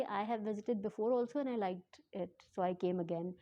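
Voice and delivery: stretched-out English answer, word by word; slowly spelled-out reply to the officer; slow, careful quote. Shown as a demonstration. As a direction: say her reply slowly so viewers follow. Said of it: "आहिस्ता-आहिस्ता आप लोग को इसलिए बता रही हूँ".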